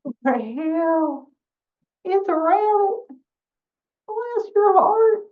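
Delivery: high, loud and slow overjoyed dog; dog greeting an old friend, high and loud; excited dog. Acted. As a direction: gush like a dog thrilled to see a friend. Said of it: "Because Dog says".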